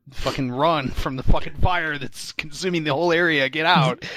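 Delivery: shouting for her to flee the fire; urgent shout. Retold or imitated. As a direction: yell at her to run from the fire. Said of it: "and you tell her, like".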